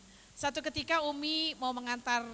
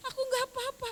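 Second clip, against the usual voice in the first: put-on very high, tearful child voice; imitating tearful grandchild; 14 semitones higher than usual. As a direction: repeat it as the crying grandchild, pitched way up. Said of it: "tapi sambil nangis gitu".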